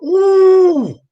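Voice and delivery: high, slow mock nun; hammed-up nun shout. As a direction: squeal it out in a nun's voice. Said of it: "He's like".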